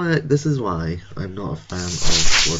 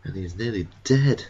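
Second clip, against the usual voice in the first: normal talking voice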